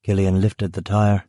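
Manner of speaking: ordinary delivery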